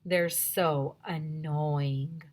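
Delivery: ordinary delivery, nothing marked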